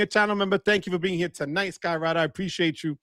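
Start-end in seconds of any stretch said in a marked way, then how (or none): none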